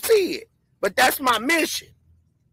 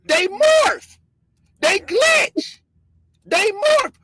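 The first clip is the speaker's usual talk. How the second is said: higher, louder hype over the clip; excited; 7 semitones higher, 3 dB louder, much slower than usual. Real, not acted. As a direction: point at the screen, fired up. Hyping a video of glitches.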